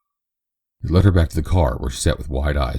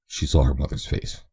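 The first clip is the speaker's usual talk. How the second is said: ordinary delivery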